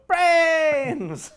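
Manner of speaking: high loud zombie cry "Brains!"; zombie shout. Retold or imitated. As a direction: howl "Brains!" like a hungry zombie. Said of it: "made me wanna go".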